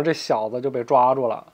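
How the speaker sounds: ordinary delivery, nothing marked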